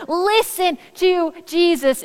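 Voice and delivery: God instructing, word by weighty word. Retold or imitated. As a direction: deliver it as God's measured command to a disciple. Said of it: "is what the voice of God tells Peter".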